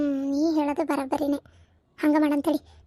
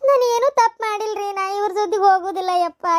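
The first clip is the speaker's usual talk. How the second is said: desperate pleading; 4 semitones higher than usual. Acted. Wife begs husband, protests innocence.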